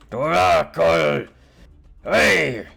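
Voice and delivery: huge, slow roar from afar; booming voice. Acted. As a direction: bellow it like a giant down a corridor. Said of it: "you hear a booming voice".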